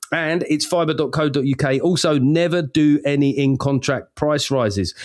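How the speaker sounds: usual speaking voice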